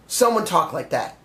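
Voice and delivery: ordinary delivery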